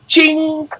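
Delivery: high, loud cymbal ching; cymbal crash. Acted. Capping his drum roll for viewers, high loud cymbal noise. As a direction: hit the cymbal sound at the end. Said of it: "Drum roll, please".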